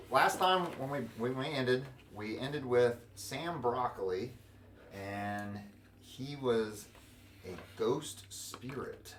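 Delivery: ordinary delivery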